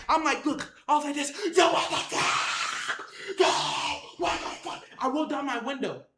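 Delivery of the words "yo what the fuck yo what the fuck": long, strained yell held back in volume; drawn-out imitated shouting; panicked mock scream. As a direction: stretch each cry like screaming from a car. Acted yelling at attackers.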